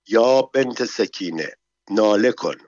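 ordinary delivery, nothing marked

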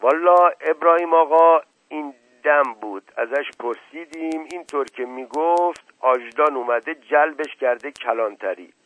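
loud, high-pitched servant voice; excitable servant's account to his master; servant reporting news. Acted. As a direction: raise the voice to report what was heard.

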